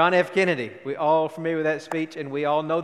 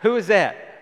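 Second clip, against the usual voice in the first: laughing question; 4 semitones higher, 6 dB louder than usual. Real, not acted. Laughing at the Buzz clip.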